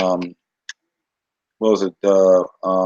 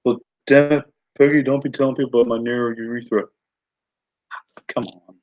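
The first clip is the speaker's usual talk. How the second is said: lightly voiced cartoon husband, hurried in the middle; cartoon husband impression; 2 semitones higher than usual. Cartoon husband telling Peggy off about gossip, lightly imitated. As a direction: do a hint of an exasperated cartoon husband. Quoting a Mike Judge cartoon.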